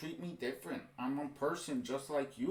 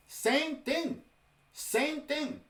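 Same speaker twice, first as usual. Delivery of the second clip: exasperated; 11 semitones higher, much slower than usual. Real, not acted. Ranting reaction to protest video.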